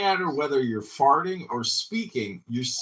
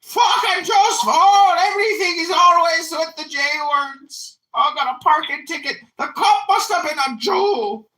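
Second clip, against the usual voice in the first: mock ranting bigot; 7 dB louder than usual. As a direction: rant like a furious crank blaming everyone. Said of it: "He's like".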